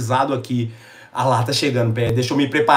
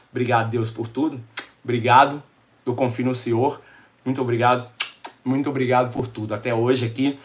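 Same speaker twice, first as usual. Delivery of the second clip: prayer; 4 semitones lower than usual. Real, not acted. Thanking God on camera. (oração baixa e sincera)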